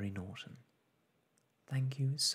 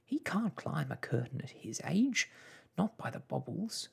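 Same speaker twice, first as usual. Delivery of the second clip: higher, agitated mother's voice; worried mother; 7 semitones higher than usual. Acted. Mother fears for father climbing curtain, agitated. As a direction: fret aloud with lively swoops.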